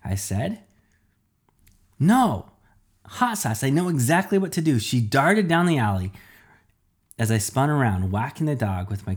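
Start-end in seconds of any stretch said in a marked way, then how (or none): 2.0-4.8 excited realization. A narrator's reading. Sudden idea in crisis.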